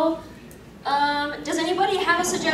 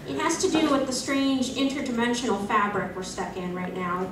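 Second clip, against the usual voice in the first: ordinary talk, nothing marked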